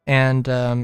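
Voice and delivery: normal talking voice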